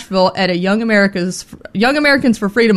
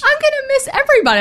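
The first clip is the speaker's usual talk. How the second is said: tearful mock goodbye; faster than usual. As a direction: voice jumps up high and fast. Emotional goodbye on air.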